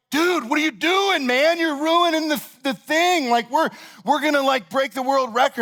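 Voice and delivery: what are you doing, man; shocked protest. Retold or imitated. Smashed with ice cream mid-run.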